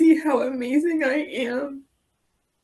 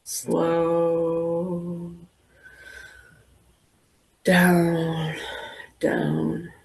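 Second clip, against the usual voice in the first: low, sung-out and slow; 7 semitones lower, much slower than usual. Shown as a demonstration. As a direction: hum the words out slowly. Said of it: "Say it really slow".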